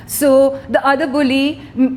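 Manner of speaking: ordinary talk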